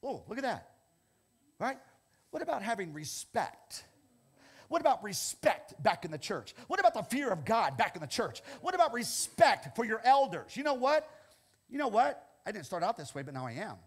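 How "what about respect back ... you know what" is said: building exhortation. Real, not acted.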